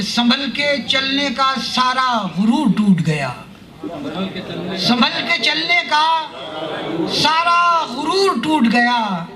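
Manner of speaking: measured Urdu verse delivery, pitched above plain talk; mushaira poetry recitation. Acted. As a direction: declaim each couplet slowly, drawing out the phrases.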